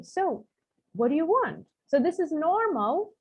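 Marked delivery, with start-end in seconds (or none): 0.0-1.7 higher-pitched "so", pause, then the tune moves on WANT; intonation at the end. Shown as a demonstration. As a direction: set up with SO, then lift and fall on WANT at the end. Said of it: "it always goes to the end".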